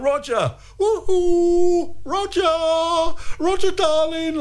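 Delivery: high, gushing woohoo to Roger; excited calling out. Retold or imitated. Host reenacting waving at Roger on TV, excited calling. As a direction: call out to him like you're waving wildly. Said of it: "I was, like, waving".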